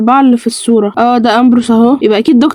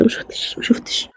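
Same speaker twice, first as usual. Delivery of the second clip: whisper; 12 dB quieter than usual. Real, not acted. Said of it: "لا مؤاخذه".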